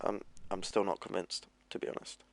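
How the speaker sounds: breathy whisper, trailing off; whispered aside. Real, not acted. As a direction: whisper it so the teams can't hear. Aside the teams shouldn't hear.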